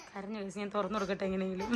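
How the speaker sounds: soft aside through a laugh. Real, not acted. Mother laughing softly to viewers as her son cries.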